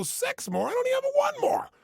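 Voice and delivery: high, loud mock whine of a worn-out trainee; exhausted protest. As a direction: squeal it like you have nothing left. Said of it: "You go".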